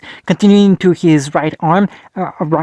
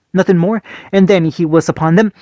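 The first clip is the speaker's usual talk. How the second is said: ordinary delivery, nothing marked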